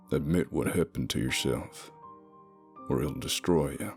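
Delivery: usual speaking voice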